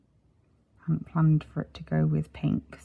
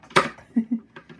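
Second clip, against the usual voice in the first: short laugh; 6 semitones higher, 5 dB louder than usual. Real, not acted. Laughing at being tired.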